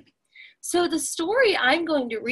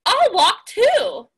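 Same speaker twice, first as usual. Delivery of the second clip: higher, louder, slow and eager; eager rabbit; 8 semitones higher, 6 dB louder, slower than usual. Acted. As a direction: rabbit happily joins in. Rabbit voiced in story.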